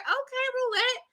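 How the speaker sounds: high sing-song okay; mock impressed. Reacting to Roulette's new look, high teasing okay. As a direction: tease her like a proud friend. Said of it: "I was like".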